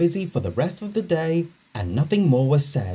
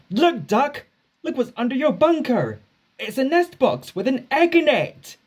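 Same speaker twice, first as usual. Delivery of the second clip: much higher, excited calling; excited crew; 12 semitones higher than usual. Acted. Duck's crew tease him about the egg, excited. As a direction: point it out with glee.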